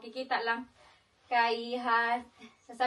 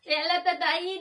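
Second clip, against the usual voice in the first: usual speaking voice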